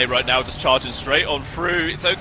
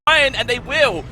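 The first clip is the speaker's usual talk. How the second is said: higher-pitched esports casting; live play-by-play; 10 semitones higher, 4 dB louder than usual. Real, not acted. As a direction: call the game action with urgency.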